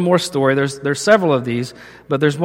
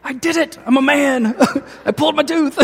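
imitating an overjoyed kid, very high; high, giddy boasting with laughter; joyful child; 11 semitones higher than usual. Preacher mimics his son proud of the pulled tooth, joyful. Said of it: "but this joy of".

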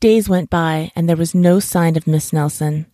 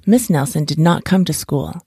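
ordinary delivery, nothing marked

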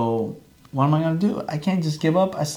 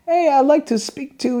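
much higher put-on caller voice; disguised voice; 11 semitones higher than usual. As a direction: fake a stranger's voice on the phone. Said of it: "I changed my voice".

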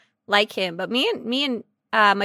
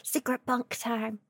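hushed aside; 11 dB quieter than usual. Pretend. Hushed joke about secret romance.

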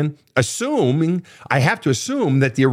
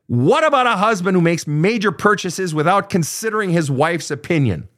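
reading the question pitched higher, half-mock yell; hammed-up shouty reading of the listener's question; mock screaming; 7 semitones higher than usual. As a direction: read it like the all-caps scream it was written in.

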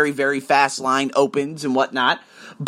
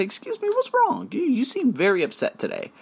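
mock sweet concern; 9 semitones higher, 3 dB quieter than usual.

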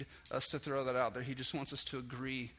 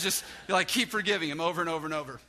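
emphatic Jesus; 3 semitones higher, 7 dB louder, faster than usual. Acted. Jesus insisting to Peter, loud and fast. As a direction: push it out, insisting without a pause.

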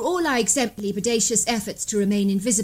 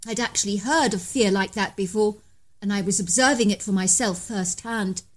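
ordinary delivery

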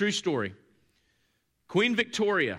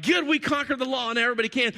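higher, quick boast; mock self-congratulation; 7 semitones higher, 4 dB louder, faster than usual. Law keepers boasting over everyone else, self-congratulating. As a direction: crow it like a smug achiever.

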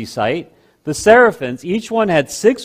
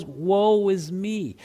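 hushed lament; 3 semitones higher, 7 dB quieter, much slower than usual. Acted. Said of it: "He sits there and he goes".

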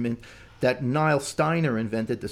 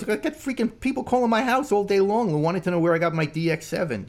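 high-pitched mock exasperation; worked-up griping in a raised pitch; exasperated complaint; 8 semitones higher than usual. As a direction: imitate a fed-up friend ranting, voice up high. Acting out friend's exasperated complaint.

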